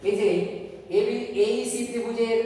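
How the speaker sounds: ordinary talk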